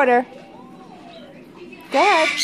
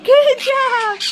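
high, loud praise for a pet; excited praise; 10 semitones higher, 6 dB louder than usual. Real, not acted. Owner praises her dancing pig, high and loud. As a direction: cheer him on up high.

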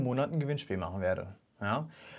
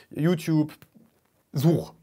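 usual speaking voice

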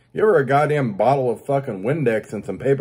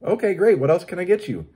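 higher, brighter, sing-song upsell; mock cheerful cashier; 6 semitones higher than usual. Customer mocking the Taco Bell cashier's chirpy upsell. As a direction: parody the chirpy drive-thru script. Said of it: "policy at Taco Bell".

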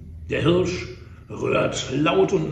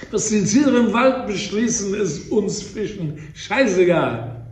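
loud defiant retort; 5 semitones higher, 7 dB louder, slower than usual. Acted. Hare interrupts as a fish.

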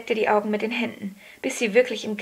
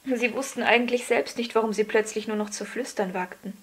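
usual speaking voice

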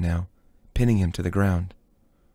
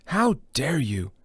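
gasped outrage; 4 semitones higher, slower than usual. Acted. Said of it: "Brahms gasped".

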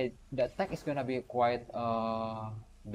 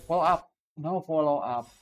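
loud; 4 semitones higher, 7 dB louder than usual. Real, not acted. Reacting to a game move.

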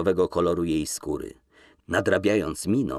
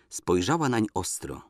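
ordinary talk, nothing marked

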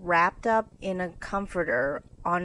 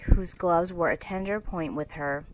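ordinary delivery, nothing marked

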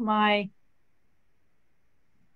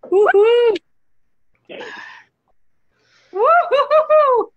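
high, loud celebratory whoop; excited whoop; 12 semitones higher, 8 dB louder, slower than usual. Real, not acted. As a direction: whoop it up after the shot. Cheering after the toast.

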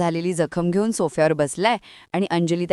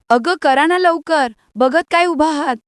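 scolding yell; 9 semitones higher, 4 dB louder than usual. A narrator's reading. Aunt urging uncle to phone the doctor, yelling. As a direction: shout at them to hurry up. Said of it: "मामी ओरडते".

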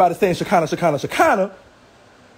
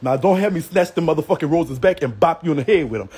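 ordinary delivery

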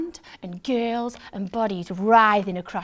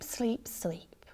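whispering; 8 dB quieter than usual. A narrator's reading. Fading into whisper.

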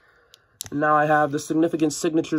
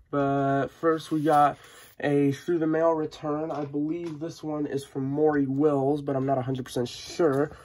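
ordinary delivery

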